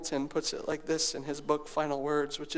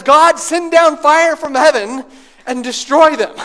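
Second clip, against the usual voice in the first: loud, higher, calling down fire; upset Elijah; 8 semitones higher, 16 dB louder than usual. Retold or imitated. Preacher voicing an upset Elijah calling down fire, loud. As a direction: rage at the people like Elijah. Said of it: "Elijah's upset and he says".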